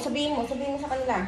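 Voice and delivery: quick hushed urging. Real, not acted. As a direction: nudge him quietly and quickly. Urging partner under her breath.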